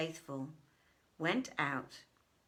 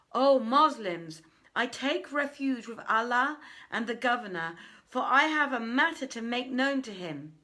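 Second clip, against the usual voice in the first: crying out; 5 semitones higher, 6 dB louder than usual. Acted. Intruder crying to the Muslims, loud plea. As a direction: cry out to be heard by all. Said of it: "cried out".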